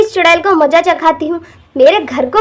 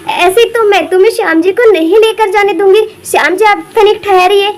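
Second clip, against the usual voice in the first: normal talking voice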